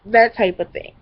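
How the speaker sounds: ordinary delivery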